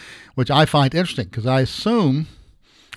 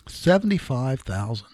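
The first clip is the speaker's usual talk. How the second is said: normal talking voice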